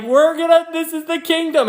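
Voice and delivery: very high, giddy exclamation; excited Peter. Acted. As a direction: act out a disciple bursting with excitement. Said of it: "he gets so excited".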